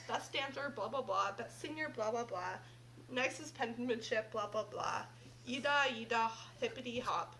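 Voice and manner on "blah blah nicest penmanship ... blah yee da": plain reading voice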